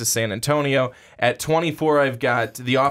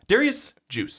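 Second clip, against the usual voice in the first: imitating Mark; 10 semitones higher than usual. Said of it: "as Mark calls him".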